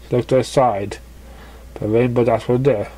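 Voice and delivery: ordinary delivery, nothing marked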